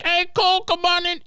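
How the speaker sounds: very high-pitched mock holler; high, squealed welcome; excited calling out. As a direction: call a friend in with a squeaky high voice. Voicing Garrett calling friend in.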